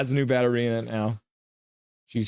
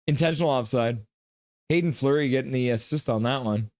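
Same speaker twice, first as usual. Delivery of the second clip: ordinary talk, nothing marked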